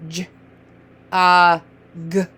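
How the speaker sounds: J, uh, g as isolated sounds with gaps; separate letter sounds. Shown as a demonstration. Teacher modelling letter sounds, each said separately. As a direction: sound out J, uh, g one by one. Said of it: "What are those sounds?".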